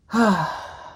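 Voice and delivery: long deflated sigh; a loud weary sigh; heavy sigh. Real, not acted. Reacting to the huge loan total.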